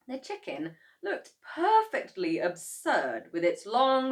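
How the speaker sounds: ordinary delivery, nothing marked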